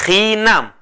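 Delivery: hinam, drawn out with a falling end; teacher models hinam slowly for repeating; slow Kaqchikel word. Shown as a demonstration. Teacher models the word for the class, slow and clear. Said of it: "Todos, por favor".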